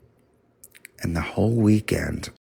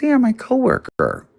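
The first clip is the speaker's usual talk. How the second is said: mock sister voice; 7 dB louder, faster than usual. Mimicking sister's answer.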